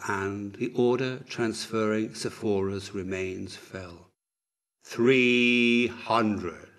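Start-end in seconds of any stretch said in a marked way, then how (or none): 4.8-6.7 long drawn-out, louder call; sustained, intoned number; slow countdown. A narrator's reading. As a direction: stretch each word like a solemn toll. Physician counts down to death.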